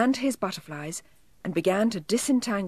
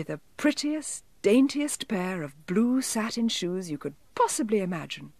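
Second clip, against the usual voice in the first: ordinary delivery, nothing marked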